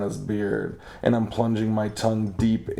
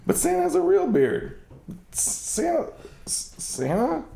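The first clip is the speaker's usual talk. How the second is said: shocked squeal. Acted. Shocked discovery.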